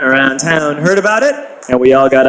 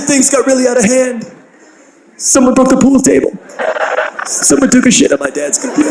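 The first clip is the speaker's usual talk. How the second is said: put-on high, fretful kid's voice; lightly voiced worried teen; upset classmate; 8 semitones higher than usual. Jake tells the narrator about the party, high fretful voice. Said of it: "And he said".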